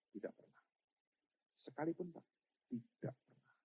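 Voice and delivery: very soft retold question and answer; quiet, grave denial; hushed solemn exchange. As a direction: answer almost under the breath.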